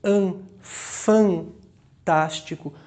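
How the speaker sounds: An, fantástico with a drawn-out nasal an in fan; nasal vowel demo. Shown as a demonstration. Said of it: "esse N serviu pra nasalizar o som do A". (An, fantástico com o an nasal bem prolongado)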